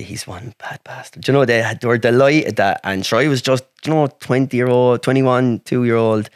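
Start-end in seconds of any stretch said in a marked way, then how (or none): 0.0-1.3 whispered aside. Real, not acted. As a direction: whisper it as a sly confidence. Nurses' secret remark about doctor.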